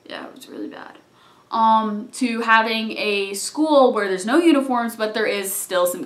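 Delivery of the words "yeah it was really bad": low, hushed, unvoiced; low whispered aside. Real, not acted. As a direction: murmur it as a grim aside. Aside about strict shoe rule.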